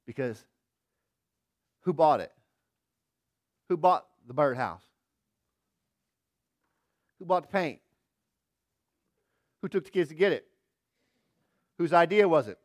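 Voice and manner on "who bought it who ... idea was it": loud, rising mock outrage; hammed-up indignant questions; who bought it. Dad jokingly demanding credit, loud and punchy.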